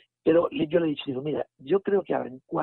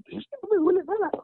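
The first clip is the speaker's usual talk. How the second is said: much higher, tearful imitation; imitated crying; 4 dB louder than usual. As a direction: mimic her weeping disbelief, pitched high. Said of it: "se tiró como dos minutos llorando".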